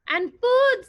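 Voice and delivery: loud, high and eager; hungry monster spotting food. Acted. Monster excited about food.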